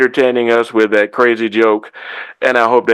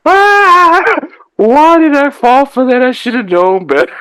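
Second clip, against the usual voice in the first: groaning laugh; 14 semitones higher, 4 dB louder than usual. Real, not acted. Groaning at the pun.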